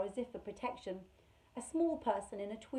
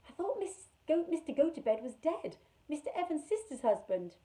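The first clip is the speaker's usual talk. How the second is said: put-on anxious girl; 6 semitones higher, 4 dB louder than usual. Carrie voiced in story.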